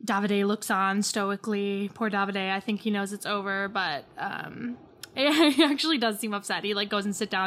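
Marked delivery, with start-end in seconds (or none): none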